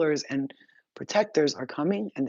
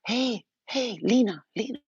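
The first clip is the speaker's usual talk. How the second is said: calling out; 7 semitones higher than usual. Retold or imitated. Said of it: "she goes".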